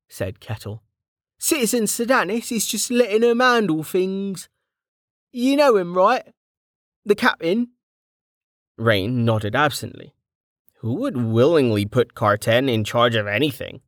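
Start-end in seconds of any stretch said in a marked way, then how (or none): 1.4-7.7 higher, rough-edged friendly soldier; Kettle's voice. A narrator's reading. Kettle greeting an old friend back from the dead, jovial. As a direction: play the cheeky old comrade, teasing.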